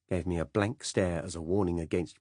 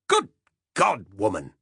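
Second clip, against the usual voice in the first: louder scandalised exclamation; exasperated outburst; 2 semitones higher, 8 dB louder than usual. Acted. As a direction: explode at her request. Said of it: "he said".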